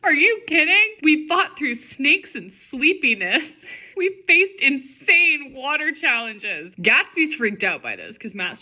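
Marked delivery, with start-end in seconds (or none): 0.0-6.8 high, loud indignant outburst, drawn out toward the end; hammed-up angry yell. A narrator's reading. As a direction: yell it in exasperated disbelief, stretching the words. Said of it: "Matt yells at her".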